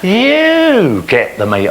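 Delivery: high, drawn-out mock outrage; mock indignant order. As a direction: stretch it out high, playing exasperated owner. Acted quarrel with pet dinosaur.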